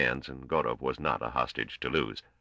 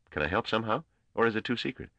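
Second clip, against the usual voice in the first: put-on a touch higher, breezy young trader; lightly voiced youngster Gorm; 8 semitones higher, faster than usual. A narrator's reading. Young trader teasing his elder colleague, lightly voiced and breezy. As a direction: play him easygoing and a bit cocky.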